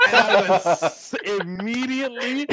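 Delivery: high, loud laughing speech; laughing through words. Real, not acted. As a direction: talk while cracking up.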